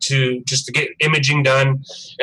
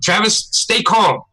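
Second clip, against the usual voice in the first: higher, drawn-out imitation of a panicked man urging calm; mock-panicked plea, slowed right down; retold panic; 5 semitones higher, much slower than usual. Dentist trying to keep his patient from freaking out, imitated panic. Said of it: "he's, like, instantly panicked".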